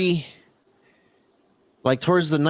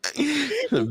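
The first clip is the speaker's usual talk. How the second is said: high laugh; 12 semitones higher than usual. Real, not acted. Laughing at the chatter's name.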